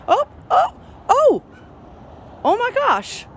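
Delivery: high, loud squealing oh-oh-oh; excited squeals. Real, not acted. Owner squealing at her cat's sudden antics, excited high squeal. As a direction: shriek with sudden delight.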